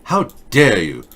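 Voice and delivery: slow, mock-indignant schoolgirl; a light jab of outrage, drawn out; lightly voiced angry girl; Greta impression. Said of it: "Everything she says is angry".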